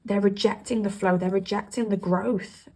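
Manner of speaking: ordinary talk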